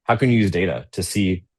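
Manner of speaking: ordinary talk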